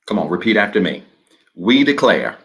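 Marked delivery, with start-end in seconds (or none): 1.6-2.4 slow, measured reading with long pauses between phrases; slow declaration reading. Shown as a demonstration. Pastor leading the online congregation through the declaration, slow and measured. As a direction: read each phrase slowly and leave room for the congregation to repeat. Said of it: "I'm gonna read it slow as I always do".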